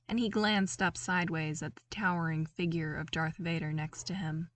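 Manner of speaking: ordinary delivery, nothing marked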